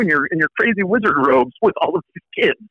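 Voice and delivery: high, eager tourist voice; excited tourists. Retold or imitated. Tourists spotting the robed professor, high excited gushing. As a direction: gush like a thrilled tourist. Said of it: "tourists stop and go".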